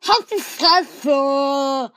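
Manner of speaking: long furious scream; angry shout. Acted. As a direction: stretch the insult out at full volume. Running gag shout.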